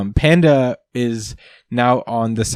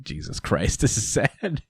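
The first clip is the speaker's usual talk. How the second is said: quiet dismay; 8 dB quieter than usual. Real, not acted. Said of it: "I'm like".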